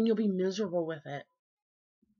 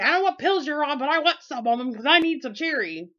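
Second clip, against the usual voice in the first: louder, mock-exasperated mother; joking mother; 6 semitones higher, 6 dB louder, faster than usual. Imitating her mother teasing her, louder and exasperated. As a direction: tease like a fed-up mom. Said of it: "And she'd say".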